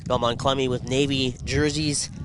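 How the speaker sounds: normal talking voice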